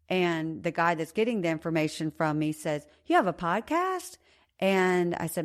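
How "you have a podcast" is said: high, amazed you have a podcast; surprised question. Retold or imitated. Officer reacting to the news of her podcast, amazed. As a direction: pitch it way up in disbelief.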